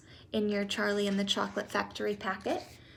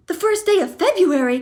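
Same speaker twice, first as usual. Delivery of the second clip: high, loud excited cry; cried out; 9 semitones higher, 11 dB louder, faster than usual. Acted. Mother cries out the date, high and loud. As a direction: Mrs Bucket cries out in surprise at the date. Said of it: "cried Missus Bucket".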